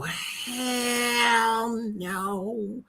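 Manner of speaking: disgusted. Real, not acted. Disgusted reaction to comment.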